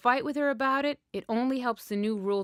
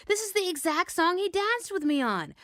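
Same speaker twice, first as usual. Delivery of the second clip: high, eager gush; excited friend recalling; 9 semitones higher than usual. A narrator's reading. Friend thrilled the song from the dance comes on, high and eager.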